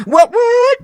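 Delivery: sudden high squeak on both words; squeaky catchphrase. Acted. Odd guest squeaking his catchphrase at the host, high. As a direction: yelp it up high like a cartoon. Catchphrase again after laugh.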